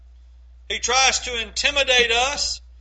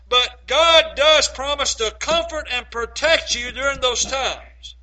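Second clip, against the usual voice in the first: normal talking voice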